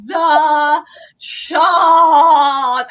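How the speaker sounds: long drawn-out, loud mock bellow; many exclamation marks. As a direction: stretch both words out as a hammed-up shout. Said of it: "Exclamation mark, exclamation mark".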